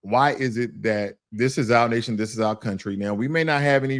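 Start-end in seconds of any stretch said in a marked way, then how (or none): none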